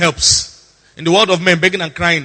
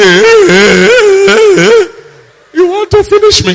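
loud, high-pitched hammed-up wail; exaggerated loud sobbing and pleading; mock crying; 12 dB louder, slower than usual. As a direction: bawl like a jilted girlfriend on the ground. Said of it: "don't cry".

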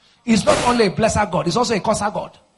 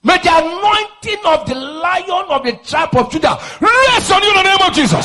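high shouted prayer, swelling louder to the end; full-force blessing; 11 semitones higher, 7 dB louder than usual. Real, not acted. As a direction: roar the blessing over the crowd.

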